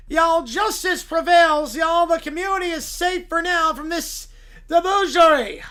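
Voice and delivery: loud, pompous Southern lawyer voice. Acted. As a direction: preach moral outrage to the judge like a Southern showman. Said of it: "Southern blue-haired lawyer".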